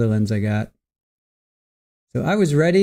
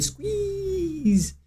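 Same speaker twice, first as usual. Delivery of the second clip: much higher, slow and drawn out; squeaky cuddly voice; 5 dB quieter than usual. Acted. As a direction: stretch it out like squeezing a teddy bear. Reading viewer's cuddly chat message.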